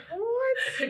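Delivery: slow, laughing "what?"; incredulous laugh through the word; amused stretched what; laughing disbelief. Real, not acted. Laughing at kids' Tagalog accent.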